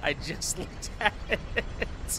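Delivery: wheezing, drawn-out words through a laugh; talking through laughter. Real, not acted. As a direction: laugh so hard the words barely get out. Cracking up at what was seen.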